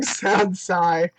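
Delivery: cracking up mid-sentence; giggling at the game text; laughing. Real, not acted. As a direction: laugh through the words. Laughs reading.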